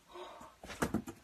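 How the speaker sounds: sharp excited gasp; sudden delighted gasp. Real, not acted. Seeing the tail.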